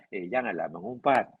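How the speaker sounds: a half-hearted request not to gossip; soft mild prohibition. Shown as a demonstration. Teacher shows the soft wording the Quran does not use, mild. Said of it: "Bukan begitu".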